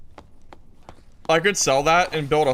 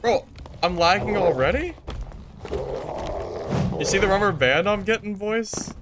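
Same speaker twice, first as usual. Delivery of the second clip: hyped gamer bro; 8 semitones higher than usual. Real, not acted. Exasperated at lag, high voice.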